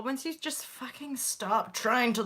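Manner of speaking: usual speaking voice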